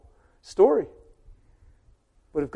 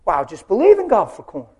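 complaining husband; 3 semitones higher, 9 dB louder than usual. Acted. Husband replies.

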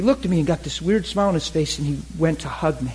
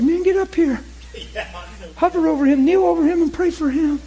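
high urgent voice; 13 semitones higher than usual. Retold or imitated. Retold orders in high strained voice.

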